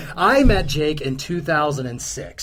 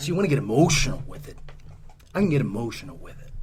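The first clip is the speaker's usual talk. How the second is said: menacing quiet; 6 semitones lower, 7 dB quieter, faster than usual. Acted. Menacing reply to rival.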